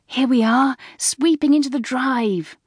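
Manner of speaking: high, thrilled arrival cry; excited schoolgirl. A narrator's reading. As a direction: sing out with eager delight.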